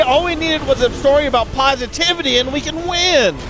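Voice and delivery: over-the-top hype call; hyped cheer. Acted. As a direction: shout it out like a big win. Sudden hyped outburst on stream.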